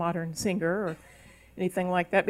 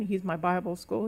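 ordinary delivery

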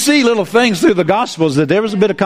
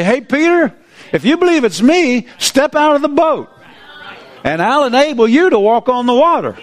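higher, lively invitation from Jesus; Jesus inviting, higher; 6 semitones higher than usual. Pretend. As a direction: call Peter out of the boat eagerly. Hypothetical line for Jesus.

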